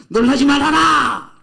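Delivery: high, loud and slow divine command. Acted. Preacher voicing God to Joshua, slow and loud. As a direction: proclaim it slowly from above.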